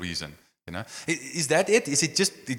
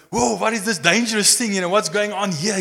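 higher, louder alarmed cry of a bystander; alarmed onlooker; 10 semitones higher, 7 dB louder than usual. Retold or imitated. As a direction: back away and cry out in alarm. Frightened crowd backing off.

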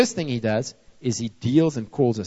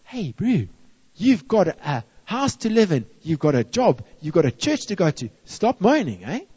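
higher, mock-stern rebuke; exasperated telling-off; 5 semitones higher than usual. Preacher scolding himself for moaning, mock-stern. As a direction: tell yourself off, pitched up and firm.

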